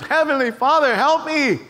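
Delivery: acted fervent appeal; fervent prayer. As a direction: cry out to God in earnest need. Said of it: "you have fervent prayers".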